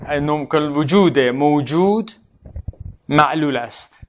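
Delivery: slow, stretched dictation; dictating. Real, not acted. Teacher writing a reworded example for students, slow dictation. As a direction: say it slowly as you write, pausing midway.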